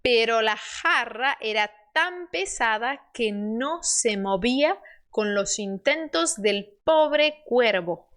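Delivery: slow, measured Spanish story reading for learners; slow model reading. Shown as a demonstration. Teacher reading the crow fable to learners, slow model reading. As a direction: read each phrase carefully, spacing the words.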